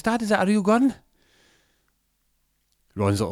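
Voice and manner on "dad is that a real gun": put-on high, loud little kid asking; child voice. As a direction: squeak it like a scared little boy. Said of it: "Benji goes".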